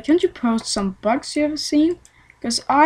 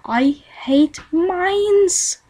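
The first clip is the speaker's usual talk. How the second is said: very slow, pitched-up whine; drawn-out disgust; 7 semitones higher, much slower than usual. Acted. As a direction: stretch it out in mock loathing. Drawn-out hate of mining.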